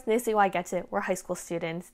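ordinary talk

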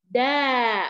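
دا said loud with lips wide open; open-mouthed da. Shown as a demonstration. Teacher showing pupils the open-lipped دا. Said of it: "شفتوا كيف فتحة؟".

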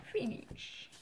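"Finish" softly whispered, with a long final sh; "Finish" said softly, mostly on breath; showing off pronunciation. Shown as a demonstration. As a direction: demonstrate "Finish" in a hushed, drawn-out way. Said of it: "j'arrive bien à dire".

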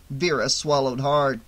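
ordinary delivery, nothing marked

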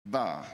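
deep short "bah" bark; low alpha bark. Retold or imitated. Owner staring down his puppy across the room, low mock bark. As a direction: bark it low to put the dog in its place. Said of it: "I'd go".

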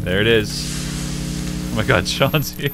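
thrilled to see a friend; excited shout. Real, not acted. Friend appears in game.

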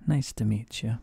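ordinary talk, nothing marked

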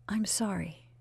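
soft unvoiced sorry; whispered apology. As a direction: breathe out the apology. Bernice contrite.

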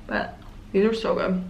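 talking through a mouthful of candy; mouth full. Real, not acted. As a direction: talk around a mouthful. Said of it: "sorry for talking with my mouth full".

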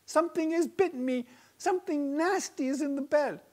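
high, fast, worried wife voice; she's been bitten, urgent and upset; alarmed wife. A narrator's reading. Wife telling her husband something bit her, alarmed.

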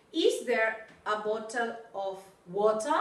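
Is there a bottle of water asked slowly; patient teacher model; careful model question. Shown as a demonstration. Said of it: "Pronúnciame bien".

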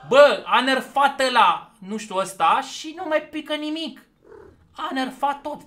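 high, loud indignant rant; loud outrage. Real, not acted. Gamer ranting that the drops were nerfed, loud outrage. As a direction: complain at the top of your voice. Complaining that huges stopped dropping.

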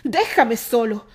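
loud, slow rebuff; shouting. A narrator's reading. Terry shouts at Candy to leave him, loud and slow. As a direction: push her away with a yell. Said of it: "Terry gritó".

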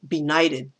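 normal talking voice

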